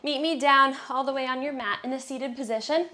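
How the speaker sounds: ordinary delivery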